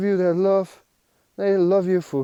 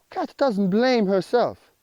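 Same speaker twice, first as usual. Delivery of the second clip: normal talking voice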